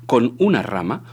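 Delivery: usual speaking voice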